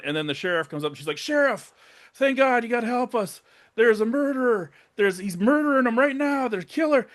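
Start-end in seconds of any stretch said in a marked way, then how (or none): 1.2-7.1 high-pitched mock panic; retold frantic plea. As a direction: imitate a desperate witness begging for help. Retelling victim begging sheriff for help.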